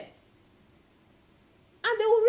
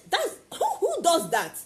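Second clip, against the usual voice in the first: incredulous scoff; 6 semitones higher, 3 dB louder than usual. Real, not acted. Mocking the begging approach.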